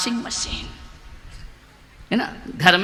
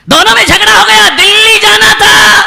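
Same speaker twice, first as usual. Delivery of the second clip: 1 loud preaching burst; 11 semitones higher, 13 dB louder than usual. Acted.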